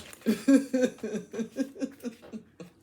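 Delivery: high burst of laughter after a rant; loud, high giggle; embarrassed laugh. Real, not acted. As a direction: laugh it off, a bit sheepish.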